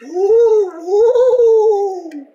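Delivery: high spooky ghost wail. Pretend. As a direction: woo like a playful ghost. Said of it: "The ghost is coming for you".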